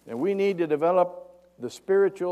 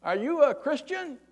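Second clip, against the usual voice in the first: high, loud call up to someone far above; acted long-distance shout; yelling up; 12 semitones higher, 7 dB louder, slower than usual. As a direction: holler up to a man on a bridge. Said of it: "he yells up to this guy".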